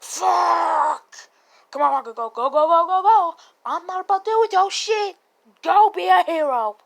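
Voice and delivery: loud, high, frantic urging; urgent yelling. Retold or imitated. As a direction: yell them into action under fire. Acted urgent yelling at Bakugo.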